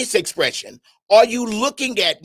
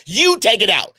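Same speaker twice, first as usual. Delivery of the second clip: defiant refusal; 4 semitones higher than usual. Acted. Acted quarrel over trash.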